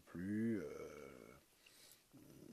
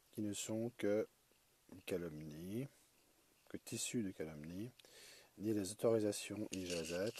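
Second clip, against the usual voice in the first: measured, projected recitation of a written passage; formal read-aloud voice with pauses between phrases; reading a text aloud; 3 semitones higher, 8 dB louder than usual. Real, not acted. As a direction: read it out phrase by phrase, lifting the phrase endings. Reads the annotation text aloud.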